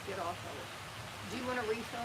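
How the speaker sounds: ordinary talk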